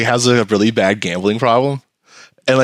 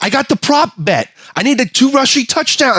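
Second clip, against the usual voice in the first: high, eager voice; excited bettor; 12 semitones higher than usual. Retold or imitated. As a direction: beg for the touchdown. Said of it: "he's like".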